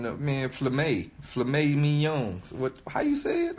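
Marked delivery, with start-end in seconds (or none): none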